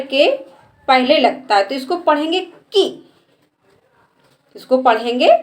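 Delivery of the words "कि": की with a short, quick vowel. Shown as a demonstration. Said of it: "इसके उच्चारण में कम समय लगता है". (छोटी मात्रा से जल्दी बोला गया की)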